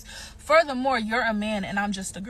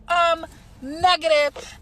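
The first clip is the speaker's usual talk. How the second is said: slow, loud, higher "negative"; sassy refusal; 6 semitones higher, 7 dB louder, much slower than usual. Pretend. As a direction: drag out the refusal with attitude. Mock answer after fake agreement.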